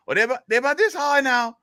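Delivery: very high comic Cajun sidekick; Cajun joke character. Acted. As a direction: play the nervous Cajun reporting back. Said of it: "get into this character here".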